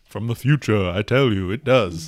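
put-on voice of someone from the future; mock prophet. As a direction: proclaim it like a playful prophecy.

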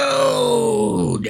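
put-on long drawn-out drunken holler; addled drunk. Host imitates the hobo spotting Fred, addled drunken holler. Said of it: "he sounds like a addled drunk".

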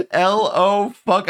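"L O fuck" high and slow; mocking over-emphasis. Streamer demonstrating the annoying post, "L O fuck" drawn out. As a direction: stretch out each word of "L O fuck". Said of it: "couldn't emphasize it anymore".

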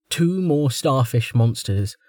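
plain reading voice

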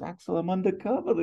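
high-pitched protest; acted squeaky objection; Jeffrey. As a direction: plead your case in a high voice. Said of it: "and Jeffrey goes".

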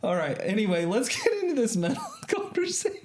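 high, still laughing; calm down from laughing; recovering from laughter. Real, not acted. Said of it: "I need to regroup".

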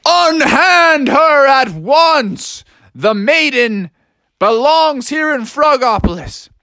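high, drawn-out theatrical command; heroic frog. Acted. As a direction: declaim grandly as a tiny storybook hero. Froggy's heroic challenge.

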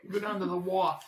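mock Boston accent on "wharf"; joking Boston accent; horrible Boston accent. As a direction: drop the r in "wharf", Boston style. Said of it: "horrible Boston accent".

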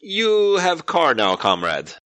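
put-on high, slow, hammy Russian accent: "You have car now"; a deliberately bad Russian accent, drawn out; mock Russian accent. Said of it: "he'll get the idea that you're".

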